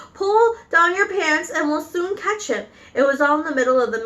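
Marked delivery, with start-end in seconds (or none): 0.1-2.7 high-pitched shouted order. Acted. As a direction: call out urgently over the commotion. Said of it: "someone else shouted".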